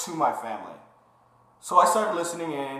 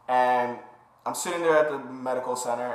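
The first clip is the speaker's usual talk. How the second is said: normal talking voice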